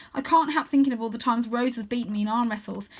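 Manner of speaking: plain reading voice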